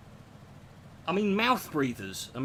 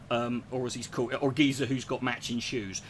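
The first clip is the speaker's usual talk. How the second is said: ordinary talk, nothing marked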